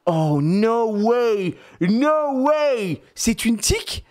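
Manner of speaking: very high, drawn-out "no way"; mock astonishment. Dad faking astonishment at daughter's pretend tick, slow and high. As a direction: stretch it out in fake shock. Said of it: "je fais comme ça".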